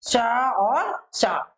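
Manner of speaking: शा और शा drawn out very slowly; three sh sounds. Shown as a demonstration. Teacher sounding out the three शा for students. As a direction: stretch each शा long so its place shows. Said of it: "तीनों शा मतलब ये शा".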